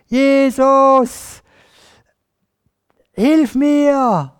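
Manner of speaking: high, loud, drawn-out cry for help; schreiender Hilferuf. Acted. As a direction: call desperately over a crowd. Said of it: "hat er geschrien". (laut und langgezogen um Hilfe schreien)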